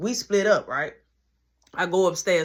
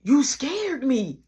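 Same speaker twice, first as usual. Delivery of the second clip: mock yell; 5 semitones higher, 4 dB louder, much slower than usual. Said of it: "Why you yell?".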